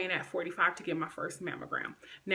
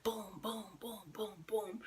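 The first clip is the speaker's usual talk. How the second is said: soft breathy boom boom chant; mock booty bounce sounds; 9 dB quieter, much slower than usual. Bullies' taunt, hushed mocking boom sounds. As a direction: whisper the booms as the bullies taunted. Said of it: "they would be like".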